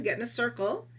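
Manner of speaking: normal talking voice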